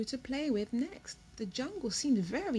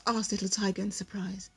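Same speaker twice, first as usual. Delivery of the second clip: plain reading voice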